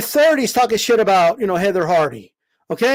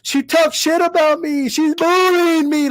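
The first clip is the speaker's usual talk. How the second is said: mocking whiny fighter; 6 semitones higher than usual. Vlogger parodying a boxer complaining about trash talk, higher mock whine. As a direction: sneer while whining like the complainer. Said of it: "Like, stop".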